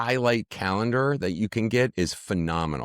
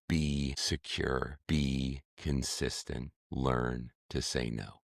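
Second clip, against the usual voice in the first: slow, softer and lower, every phrase deliberate; serious and earnest; 6 semitones lower, 8 dB quieter, slower than usual. Real, not acted. A parenting coach urges parents, slow and serious. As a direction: lay it down slowly like a vow. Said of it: "see the seriousness in my face".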